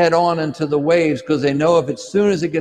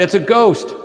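a higher, louder cry of "It's a ghost!" in terror; frightened cry; 6 semitones higher, 4 dB louder than usual. A narrator's reading. Disciple in the storm-tossed boat cries out in fear. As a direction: shout it out as if you've seen the dead. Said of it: "someone cries out".

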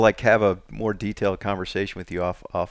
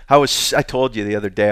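ordinary delivery

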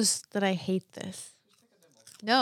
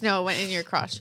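usual speaking voice

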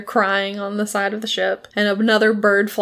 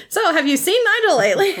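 nervous mock innocence; 10 semitones higher, faster than usual. Steed nervously questions Chauncey.

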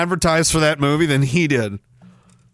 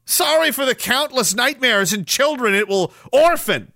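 mock indignant rant; 6 semitones higher than usual. Mock outrage at chat apology.

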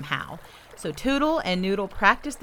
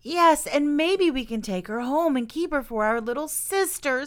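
put-on higher, eager young beaver; eager excitement; 6 semitones higher than usual. A narrator's reading. Young beaver wanting to keep the baby as sister, eager excitement. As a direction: bubble over with the new plan.

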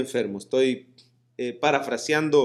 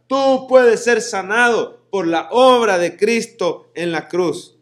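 louder, higher, slow declaration to your neighbour; loud proclamation; 7 semitones higher, 8 dB louder, slower than usual. Shown as a demonstration. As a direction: say it out to the person beside you. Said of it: "Dígale al que tiene la par". (el predicador lo proclama fuerte y despacio a quien tiene al lado)

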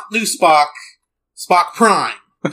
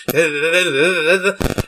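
rapid-fire commercial patter; Micro Machines guy. Retold or imitated. Co-host imitating an old toy commercial, really fast. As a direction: rattle it off, running into quick nonsense syllables. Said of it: "talked like this really fast".

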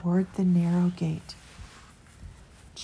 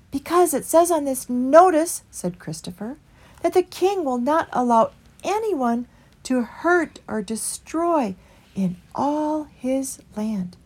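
higher mild voice of a small creature; meek small creature pleading for the way; Christopher; 7 semitones higher, 4 dB louder than usual. A narrator's reading. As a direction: lift into Christopher's gentle, higher voice. Christopher explains.

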